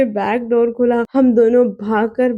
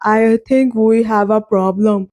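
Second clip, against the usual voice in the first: slow, careful English in a different voice; Ronaldo, gently; 2 semitones lower, much slower than usual. A narrator's reading. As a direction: lay it out slowly, stunned. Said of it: "वो धीरे से बोला".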